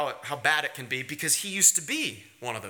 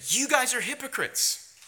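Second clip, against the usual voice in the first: a touch of blunt scolding, pitched up; a hint of a stern rebuke, higher; 6 semitones higher than usual. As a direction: call them out bluntly. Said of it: "He's not very gentle".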